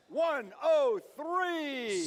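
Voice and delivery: slow booming team call; drawn-out announcer call. Real, not acted. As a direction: stretch it like an arena announcer. Announcer calling team number.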